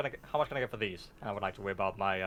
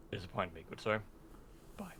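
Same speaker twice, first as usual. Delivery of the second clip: very stern; 8 dB quieter than usual. Acted. Player's character frowns at the shopkeeper on leaving, very stern. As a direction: deliver a restrained, stern rebuke. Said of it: "in a very stern voice".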